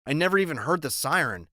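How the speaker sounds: ordinary delivery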